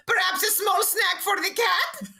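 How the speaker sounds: very high, slow, put-on voice; imitated cat-joking salesman.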